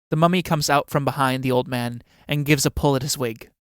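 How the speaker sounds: normal talking voice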